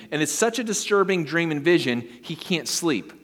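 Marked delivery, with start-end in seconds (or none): none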